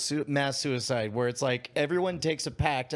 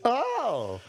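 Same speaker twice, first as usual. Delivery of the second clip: ordinary talk, nothing marked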